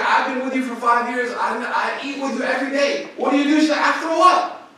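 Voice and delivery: half-acted shocked exchange between friends; upset friend. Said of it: "her best friend's like".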